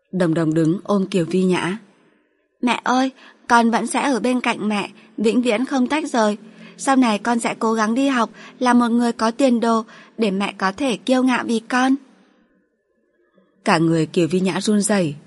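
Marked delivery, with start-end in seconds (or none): 2.6-12.0 put-on higher, earnest childlike voice; a small girl making a solemn promise; young daughter. Daughter promising her mother she will always stay, higher childlike voice.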